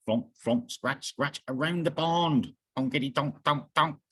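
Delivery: ordinary delivery, nothing marked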